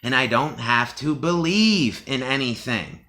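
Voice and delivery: normal talking voice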